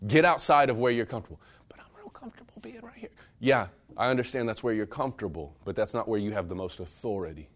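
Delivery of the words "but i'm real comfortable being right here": quick, high, whispered mock objection; timid protest. Listener resisting the challenge to leave comfort, timid whisper. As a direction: imitate a meek little whisper of reluctance.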